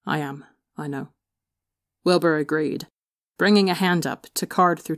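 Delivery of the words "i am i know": much softer, gentle; soft admission. A narrator's reading. Brother softly agrees while holding Tommy. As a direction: concede it quietly.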